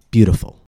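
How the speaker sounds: "Beautiful" with the T like a quick D; tapped T. Shown as a demonstration. Teacher applying the rule for learners, tapped T in "Beautiful". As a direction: flap the T in "Beautiful". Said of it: "we pronounce it with the tap".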